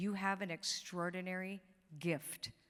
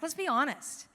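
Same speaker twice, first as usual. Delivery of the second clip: ordinary talk, nothing marked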